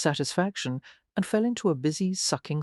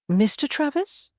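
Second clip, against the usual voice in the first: crisp, raised questioning tone; crisp, higher-pitched polite question; crisp query; 11 semitones higher, 3 dB louder than usual. A narrator's reading. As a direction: ask it crisply, lifting at the end. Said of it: "I asked crisply".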